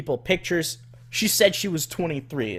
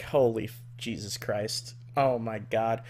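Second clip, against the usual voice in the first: exasperated mutter; 4 semitones lower, 7 dB quieter than usual. Retold or imitated. Exasperated aside.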